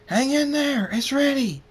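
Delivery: high, loud, urgent scream. A narrator's reading. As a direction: scream encouragement to someone far away. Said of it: "Draconic screams".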